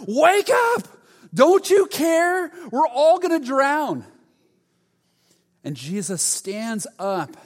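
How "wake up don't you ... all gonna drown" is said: much higher, louder panicked yell; frightened disciples shouting over the storm. Retold or imitated. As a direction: scream at him to wake up, terrified. Disciples wake Jesus in storm.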